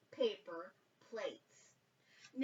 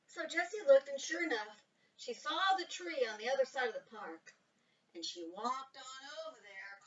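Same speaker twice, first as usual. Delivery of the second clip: ordinary delivery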